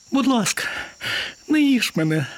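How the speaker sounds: high, begging little mouse voice; pleading mouse. Acted. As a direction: beg for your life in a squeak. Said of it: "стала благати мишка". (мишка тоненько благає)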